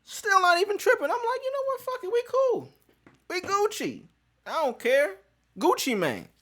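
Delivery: high, loud devil-may-care shrug; carefree. Retold or imitated. Waiting for the tester, brushing it off in a high carefree voice. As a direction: toss it off like nothing matters.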